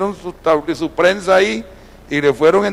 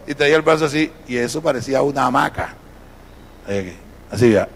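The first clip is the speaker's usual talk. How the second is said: usual speaking voice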